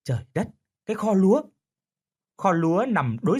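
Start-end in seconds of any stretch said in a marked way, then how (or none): 0.0-1.4 startled gasp of disbelief; surprised. A narrator's reading. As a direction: blurt it out in surprise. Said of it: "Lâm ngạc nhiên".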